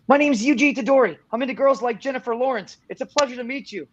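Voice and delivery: put-on upbeat anime boy introduction; Yuji Itadori. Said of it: "a line as Yuji".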